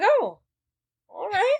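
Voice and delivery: high "oh, all right" backing off; sarcastic high-pitched concession; high mock surprise. Jocks voiced backing down.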